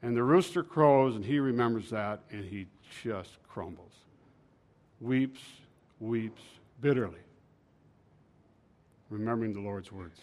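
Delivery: low, hushed telling, sinking away; sorrowful narration. A narrator's reading. Preacher telling of Peter's bitter weeping, hushed and sorrowful. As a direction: let the voice drop softly with sorrow.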